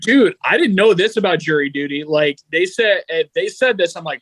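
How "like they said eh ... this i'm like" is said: usual speaking voice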